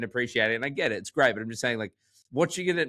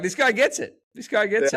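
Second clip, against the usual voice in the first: much higher and louder, gleeful praise; delighted approval; 11 semitones higher, 6 dB louder than usual. Retold or imitated. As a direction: cheer him on like a hero. Cheering a stranger's bar comment.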